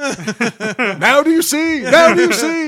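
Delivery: high squealing reveal. Pretend. Shirt off, mock display.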